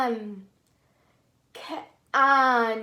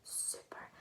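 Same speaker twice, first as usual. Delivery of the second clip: soft whispered praise; 24 dB quieter than usual. Shown as a demonstration. Teacher whispering praise to the children. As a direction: breathe it out with no voice. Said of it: "in a whisper voice".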